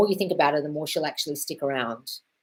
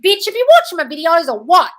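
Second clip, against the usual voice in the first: loud, high, furious comeback; mock angry outburst; 11 semitones higher, 13 dB louder than usual. To a TikTok commenter who doubted her, an angry yell. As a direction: blast the commenter like you mean it. Retold snapping back at commenter.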